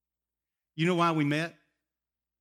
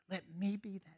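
hushed voice of the Lord; 5 semitones higher, 7 dB quieter, much faster than usual. Retold or imitated. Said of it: "And the Lord says".

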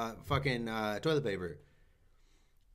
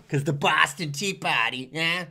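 hammy fake Boston accent, a bit louder; bad Boston accent; 3 semitones higher, 3 dB louder than usual. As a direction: throw on a cheesy Boston accent. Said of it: "I do a bad Boston accent".

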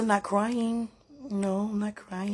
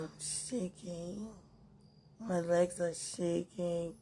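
slow and much softer, shaky with emotion; trembling; 7 dB quieter, slower than usual. Real, not acted. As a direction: let the body shake through the words.

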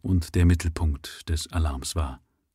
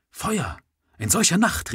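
higher, startled exclamation; excited cry; 10 semitones higher than usual. A narrator's reading. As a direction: Oberon leaps up, crying out in new excitement. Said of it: "rief er".

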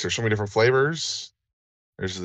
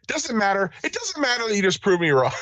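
sarcastic dismissal; 13 semitones higher, faster than usual. Real, not acted. Mock dismissal after being corrected.

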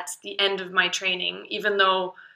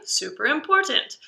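ordinary delivery

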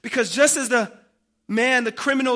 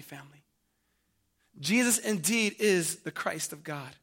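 usual speaking voice

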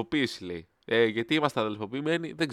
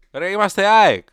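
indignant shout; 8 semitones higher, 10 dB louder, slower than usual. Real, not acted. Angry outburst about fan brotherhood.

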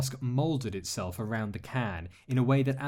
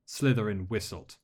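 ordinary delivery

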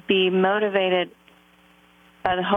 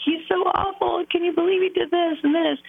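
retold crying; 10 semitones higher than usual. Telling best friend about awful husband, high tearful wail. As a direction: replay her own sobbing complaint, pitched way up. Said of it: "I'm crying and crying".

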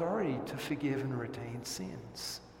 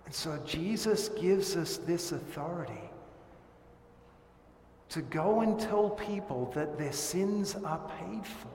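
usual speaking voice

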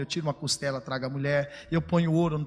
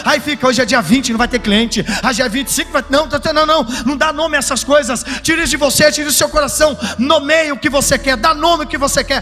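loud, high-pitched preaching over music; impassioned pastor preaching to the congregation; fiery preaching; 7 semitones higher, 10 dB louder than usual. Acted. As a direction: preach it at full force, building. Preacher at full force with music.

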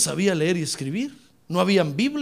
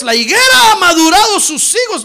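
very loud, high scripture proclamation; preaching shout; 9 semitones higher, 12 dB louder than usual. Real, not acted. Preacher reading verse thirteen aloud, full-force shout. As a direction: ring the verse out over the congregation.

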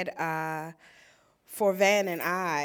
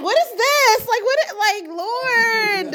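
much higher and louder, fake crying; mock wail; 13 semitones higher, 9 dB louder, slower than usual. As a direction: whine to heaven in mock despair. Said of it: "I was like".

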